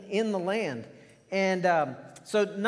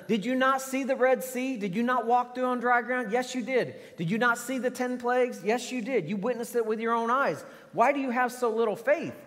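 fiery preaching; 6 semitones higher than usual. Acted. Preaching rebuke at full force.

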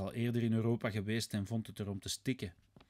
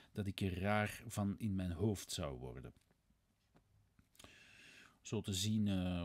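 usual speaking voice

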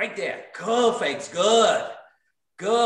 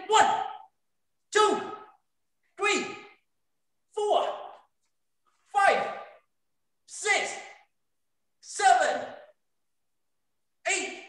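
high, called-out count; drill count; 7 semitones higher, 3 dB quieter, much slower than usual. Real, not acted. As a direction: call each rep to the class. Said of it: "instructor counting out drill reps".

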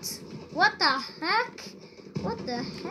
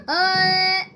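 shocked gasp; 3 semitones higher, 9 dB louder than usual. Real, not acted. Shocked at game.

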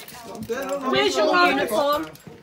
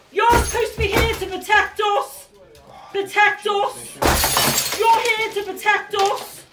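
furious pleading; 4 semitones higher, slower than usual. Real, not acted. Woman confronting police officers.

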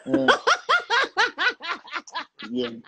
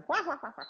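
mad scientist cackle; 11 semitones higher than usual. Retold or imitated. Karen's laugh, retold in story.

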